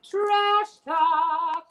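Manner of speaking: high, loud, long-held words; show title call. Acted. Host signing off his show, big announcer call. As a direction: belt out the title, stretching each word.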